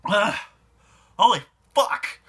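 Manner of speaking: sudden loud cry of pain; pained outburst. Real, not acted. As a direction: blurt it out, really hurting. Pain peaks during pepper challenge.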